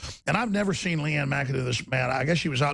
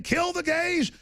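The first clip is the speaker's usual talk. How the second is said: pitched way up, mocking the imam; mock quote; 12 semitones higher, slower than usual. Host mocking the imam's words, pitched way up. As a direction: sneer out the quote. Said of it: "his daddy and his imam saying".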